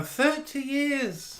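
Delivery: high, slow, hammed-up soap-opera line; campy soap star sighing over thirty years; dramatic soap voice. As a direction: stretch it out like a TV melodrama. Theatrical soap catchphrase.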